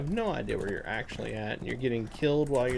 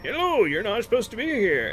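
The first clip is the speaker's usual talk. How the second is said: higher, louder mock NPC greeting; imitating game NPC; 10 semitones higher, 4 dB louder than usual. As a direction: parrot the same canned line. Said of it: "the next time you click on him".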